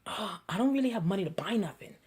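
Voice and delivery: quick low whisper; hushed, fast whisper; whispered aside. Pretend. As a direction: confess it under your breath. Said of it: "you're like".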